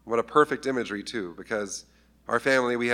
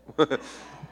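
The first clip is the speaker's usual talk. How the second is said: breathy laugh; 3 semitones higher than usual. Real, not acted. Pastor laughs at daughter story.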